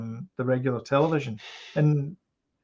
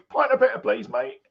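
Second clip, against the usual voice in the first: mock London barman; 10 semitones higher than usual. Mimics London bar staff.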